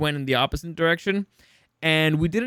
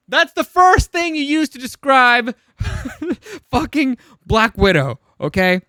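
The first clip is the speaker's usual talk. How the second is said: exasperated rant; 8 semitones higher, 5 dB louder than usual. Real, not acted. Ranting about Scarlett's accent.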